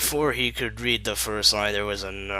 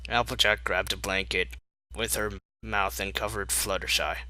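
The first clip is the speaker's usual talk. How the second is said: ordinary delivery, nothing marked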